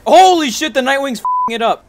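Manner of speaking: freaking out as it chases; scared horror game streamer. Real, not acted. Mammoth chasing him in game.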